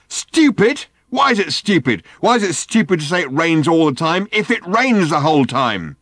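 high, loud indignant outburst; affronted. A narrator's reading. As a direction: fire back at full volume, outraged.

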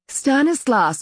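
put-on high-pitched call to a child; mother calling out. A narrator's reading. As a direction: call the name out across the yard. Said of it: "called the mother".

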